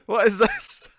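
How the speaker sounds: high, loud, baffled demand; Picard's bewildered outrage. Retold or imitated. Picard reacting to the ship being taken over, appalled. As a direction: demand it in mock disbelief. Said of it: "Picard just goes".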